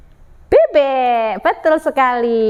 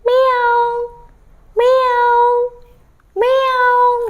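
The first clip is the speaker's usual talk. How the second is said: high, smooth, held meows; gentle cat meow; 9 semitones higher, much slower than usual. Shown as a demonstration. Storyteller leads children in copying cat, high meow. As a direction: meow high and sweetly like a kitten. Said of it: "suaranya lembut sekali".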